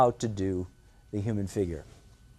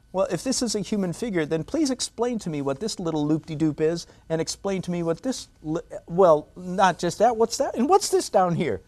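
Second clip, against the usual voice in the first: higher, questioning mock student voice; imitating a puzzled student; 6 semitones higher than usual. Student challenging the teacher about his loops, higher and puzzled. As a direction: pitch it up like a confused beginner picking at each mark. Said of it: "you might say to me".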